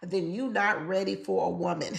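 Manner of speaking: normal talking voice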